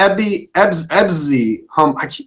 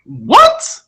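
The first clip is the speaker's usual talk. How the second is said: high-pitched, incredulous yelp; astonished; 12 semitones higher, 3 dB louder than usual. Real, not acted. Host hearing the guest is finishing law school, astonished. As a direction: blurt it out in disbelief, pitch leaping up. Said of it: "do you get that, uh, reaction often? Do people seem surprised".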